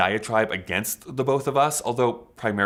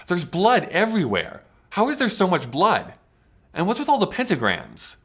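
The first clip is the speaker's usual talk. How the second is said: high-pitched, hammed-up alarm; mock panic; 8 semitones higher than usual. Comedian acting out a gory discovery in mock panic. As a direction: pitch it up in pretend horror. Acts out alarm at imagined conflict.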